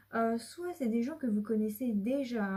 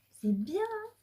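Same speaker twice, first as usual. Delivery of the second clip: much higher, bright excited squeak; delighted squeal; 11 semitones higher, slower than usual. Real, not acted. Reader delighted by the cards, squealing high. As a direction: gush with delight.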